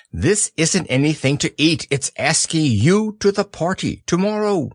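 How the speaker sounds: high, fussy owl; bright know-it-all bird. Acted. Owl speaking.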